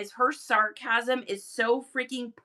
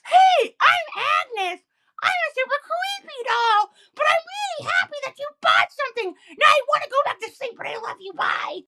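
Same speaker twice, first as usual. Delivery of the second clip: high, loud squeaky doll voice; shrill toy doll chirping cheerfully; creepy doll Agnes; 6 dB louder than usual. Pretend. Streamer voicing her friend's creepy doll assistant, high squeaky doll voice. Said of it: "Agnes comes on the screen and it's like".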